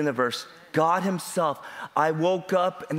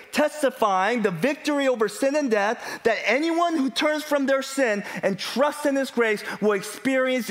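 high-pitched, driving sermon delivery; fervent gospel preacher; impassioned preaching; 7 semitones higher than usual. Real, not acted. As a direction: proclaim it to the congregation in a raised pitch. Preaching at full force.

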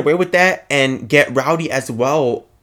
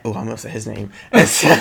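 ordinary talk, nothing marked

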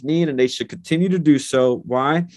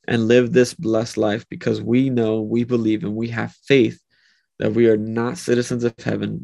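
ordinary delivery

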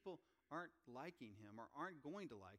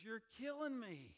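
impassioned Paul; 9 semitones higher, 6 dB louder, slower than usual. Retold or imitated. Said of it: "I'll paraphrase here. He goes".

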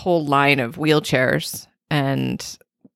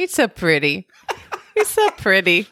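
giggling through the quote; quoting with a giggle; laughing while quoting; 3 semitones higher than usual. Retold or imitated. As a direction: laugh out the repeated compliment. Laughing imitation of groggy partner.

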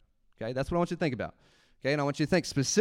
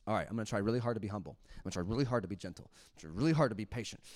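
fast, soft rattled-off resolutions; mock self-resolve; 5 semitones lower, 6 dB quieter, much faster than usual. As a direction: rush through it like a hollow promise. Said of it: "your takeaway from this message is".

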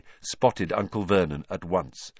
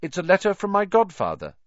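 cheerful; 9 semitones higher, 4 dB louder than usual. A narrator's reading. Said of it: "said Harry cheerfully".

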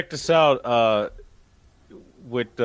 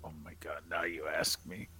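whispering; 7 semitones lower, 14 dB quieter than usual. Real, not acted. Put on the spot, whispered.

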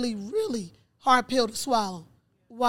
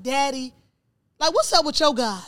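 louder, upset reproach, pushed upward; retold tearful challenge; 3 semitones higher, 7 dB louder than usual. Daughter calling her father about his God, retold tearful reproach. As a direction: challenge him in hurt disbelief, as a retold outburst. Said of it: "like, boohoo crying".

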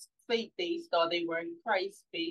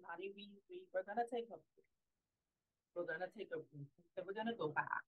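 soft retold reply; 13 dB quieter than usual. Mother quoting her own soft reply.

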